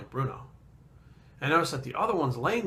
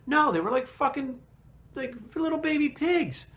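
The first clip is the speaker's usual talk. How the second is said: indignant disbelief; 10 semitones higher than usual. Real, not acted. Outraged that baby pigs get killed.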